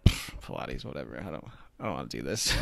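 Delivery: low, soft scoffing brush-off; a dismissive low mutter, brushing off the class. Retold or imitated. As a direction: scoff at the very idea of Pilates. Scoffing at the idea of Pilates.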